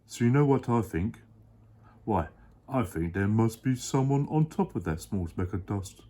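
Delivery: a big kind elephant voice, sure of himself; deep Horton voice, earnest. Acted. Dad as Horton explaining to his child.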